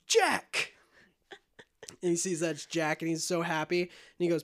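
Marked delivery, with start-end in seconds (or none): 0.1-0.7 high, loud cry of recognition; acted startled shout. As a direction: shout the name as if jolted awake. Gibbs recognizes who woke him.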